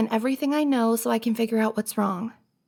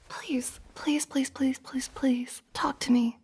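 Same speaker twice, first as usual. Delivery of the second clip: soft, half-whispered begging; hushed pleading; 5 dB quieter, slower than usual. Acted. Begging a crying friend to talk, hushed pleading. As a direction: plead under your breath, softly repeating.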